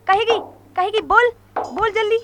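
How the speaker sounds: loud, furious demand to confess; furious shouting. Acted. Sister shouting at the maid to make her talk, furious.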